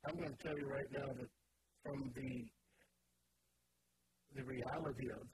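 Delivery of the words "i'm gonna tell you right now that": ordinary delivery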